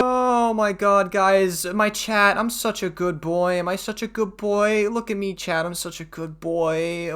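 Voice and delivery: high, slow, simpering voice of a fawning goody-goody; mocking good boy. Streamer mocks the other streamer to chat, high simpering fake voice.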